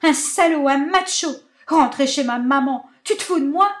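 high, loud angry yelling; screaming insults. Acted. Marinette yelling insults at Benjamin, high loud fury. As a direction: scream each insult at him.

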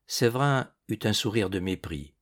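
usual speaking voice

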